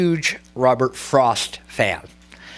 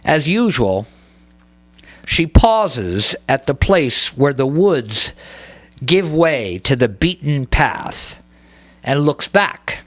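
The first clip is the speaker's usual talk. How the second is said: slow, measured verse with long pauses; poetry reading; slower than usual. Acted. Poet reading a poem to a bookstore audience, slow and deliberate. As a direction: set each image apart, lingering on the words.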